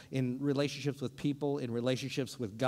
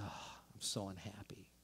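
much softer, lower dejected sigh of a line; mock despair; 7 semitones lower, 13 dB quieter than usual. Preacher voicing a hurting spouse, soft and low. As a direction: let it sink out in regret. Realizing the pain.

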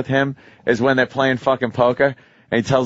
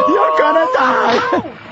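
high, slow, sobbing yell; crying shout; 12 semitones higher, slower than usual. Retold or imitated. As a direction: wail it through tears. Imitating crying shout from scene.

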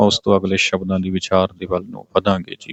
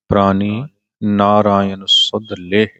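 ਪ੍ਰਾਨੀ set apart by a clear pause; pause after ਪ੍ਰਾਨੀ; slower than usual. Shown as a demonstration. Teacher showing where the pause falls, a clear break after ਪ੍ਰਾਨੀ. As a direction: say ਪ੍ਰਾਨੀ, pause, then read on slowly. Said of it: "ਪ੍ਰਾਨੀ ਤੇ ਵਿਸ਼ਰਾਮ ਆਏਗਾ".

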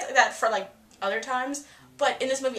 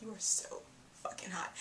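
softer whispered compliment, hushed; whispered swoon; 12 dB quieter than usual. Retold or imitated. Fan whispering to Emma on screen, softer and hushed. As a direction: murmur it like a secret crush. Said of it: "I'm just like".

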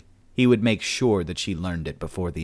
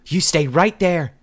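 high, loud, slow command; cornered woman warning off the killer; defiant warning; 10 semitones higher, 7 dB louder than usual. A narrator's reading. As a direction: hold him off with a shouted warning. Jen facing down her attacker.

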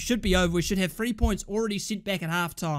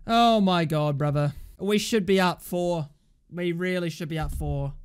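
ordinary talk

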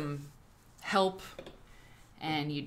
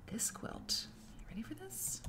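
hushed teasing whisper; 8 semitones higher, 15 dB quieter than usual. Real, not acted. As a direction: whisper to build suspense. Teasing the quilt's name.

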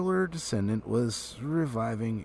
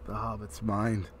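ordinary delivery

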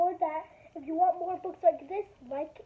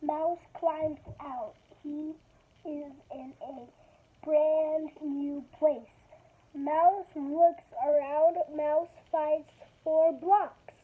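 slow, careful picture-book reading; reading to children; 4 dB louder, slower than usual. Acted. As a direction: read each page slowly to kids. Reads picture book aloud.

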